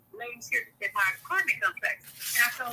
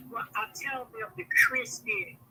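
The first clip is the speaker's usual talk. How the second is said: usual speaking voice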